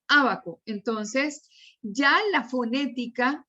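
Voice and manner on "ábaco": "abaco" stressed on the opening A; correct first-syllable stress. Shown as a demonstration. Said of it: "digo abaco". ("abaco" con acento en la primera, Á-ba-co)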